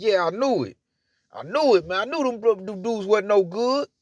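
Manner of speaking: smug told-you-so. Retold or imitated.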